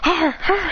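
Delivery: high imitated beast cries; creature roars. Silvally going crazy, high mock roars. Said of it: "Silvally goes crazy".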